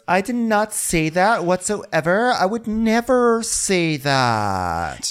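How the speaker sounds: high, slow, drawn-out mock denial; Victoria. Denying she said it, drawn-out hammed-up indignation. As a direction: stretch out the last words in high protest. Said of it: "And Victoria goes".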